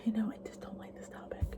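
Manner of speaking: hushed, breathy confession under the breath; whispered aside. Real, not acted. Vlogger confiding to viewers she dislikes the topic, whispered. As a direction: whisper it like a guilty secret to the camera.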